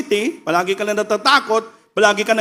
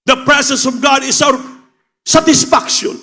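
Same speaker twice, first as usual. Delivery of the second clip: impassioned preaching; 8 semitones higher than usual. Acted. Preacher promising revival to the congregation, fervent and soaring. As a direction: lift the congregation up with joy. Preaching joy in God's presence.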